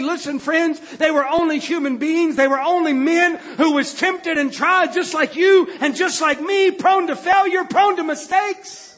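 driving, emphatic sermon delivery; fervent preaching. Acted. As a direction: preach it hard with a rising build. Preaches at full force.

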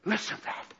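a breathless whisper of wonder; God whispering in awe. Acted. God whispering to the angels about the prayer. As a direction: whisper it as if not to break the moment. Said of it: "and say".

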